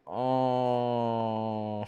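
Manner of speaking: long, held "ohhh" groan; long indecisive groan. Real, not acted. Streamer stuck choosing what they want, drawn-out groan. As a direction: groan steadily while stuck on a choice. Streamer unsure which upgrade to pick.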